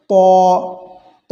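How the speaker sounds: ত as a heavy, rounded "taw"; ত held with a thick, ringing sound; full-mouthed letter. Shown as a demonstration. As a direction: let ত resonate in a full, hollow mouth. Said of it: "মুখটা ভর্তি আওয়াজ হবে".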